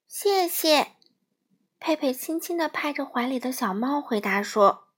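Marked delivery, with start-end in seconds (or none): 0.1-1.0 put-on little girl. A narrator's reading. Peipei answers, child voice.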